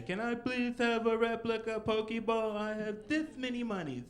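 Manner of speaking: put-on high, slow childlike plea; a small child begging for a toy. Asking a store clerk for a Pokeball, childlike and high. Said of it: "like a child".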